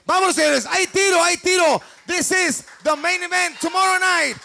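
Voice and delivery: high, energetic fight announcer calls; hype announcer. Real, not acted. Hype host calling out fighters.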